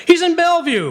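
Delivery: sudden loud, high blast of outrage; outraged outburst. Acted. Boss bursting out at his nervous lawyer, outraged. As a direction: explode at the news like a furious boss.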